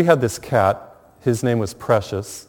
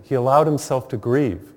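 usual speaking voice

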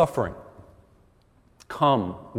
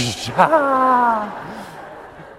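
high laugh; 9 semitones higher than usual. Real, not acted. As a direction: let a high laugh burst out. Laughing at his own punchline.

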